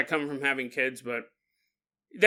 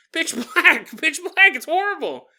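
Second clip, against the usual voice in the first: high, loud mock-traumatized child; comic high-pitched complaint; imitated distraught kid; 10 semitones higher, 5 dB louder than usual. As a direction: wail it like a scared kid, hammed-up. Voices kid left in dark room.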